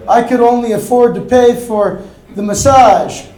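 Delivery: ordinary delivery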